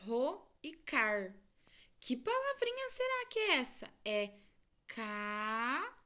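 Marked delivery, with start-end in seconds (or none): 0.1-1.4 "ro e car" dito devagar, sílaba por sílaba; syllables spelled out. Shown as a demonstration. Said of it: "tem duas sílabas". 2.0-3.9 playful quiz. Acted. Teacher quizzing children.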